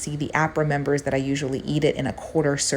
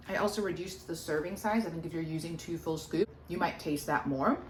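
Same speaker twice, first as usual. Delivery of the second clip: mouth full; 9 dB quieter than usual. Real, not acted. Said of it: "Now I'm talking with my mouth full".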